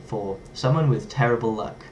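ordinary talk

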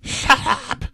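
high-pitched, sudden yell; short, high narrated yell. A narrator's reading. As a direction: yell it high as an angry order. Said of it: "Marge then yells at Bob".

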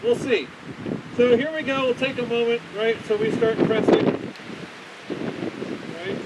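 muffled behind face mask; speaking through a respirator. Real, not acted. Seen 2: Speaker wears a half-face respirator with pink filters throughout; the reference frames show no mask.

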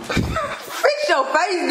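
very slow laughing order; laughing tease. Real, not acted. Husband laughing at wife, telling her to fix her face. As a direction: stretch it out while laughing.

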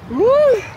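high gasp from the cold. Real, not acted. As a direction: gasp at the chill. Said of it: "het is zo koud". (hoog hijgen van de kou)

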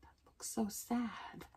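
soft, half-whispered aside; hushed sympathy. Real, not acted. Reader shows a child the cut tree, hushed.

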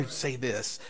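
murmured prompting; whispered prompt. Retold or imitated. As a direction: hush it like a voice in the ear. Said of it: "somebody whispered in their ears".

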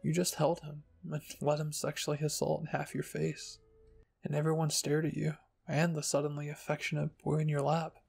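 ordinary delivery, nothing marked

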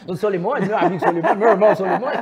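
loud laughing through the words; cracking up while saying it. Real, not acted. Joking about his duo partner.

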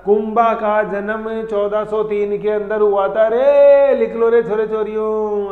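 loud, slow mock dictation to the class; hammed-up old-school teacher dictating notes; a teacher parodying boring dictation, loud and slow; mock rote dictation. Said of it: "जैसे लिखाने में तो मैं भी लिखा देता".

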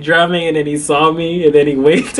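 usual speaking voice

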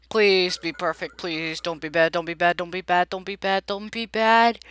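repetitive, urgent begging that builds; anxious pleading. Real, not acted. Player begs the game for a good result, anxious pleading.

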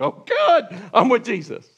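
laughing, high oh good; delighted, laughing relief; relieved laughter. Acted.

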